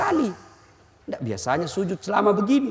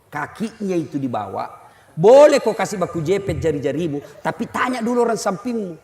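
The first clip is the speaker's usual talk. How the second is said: ordinary delivery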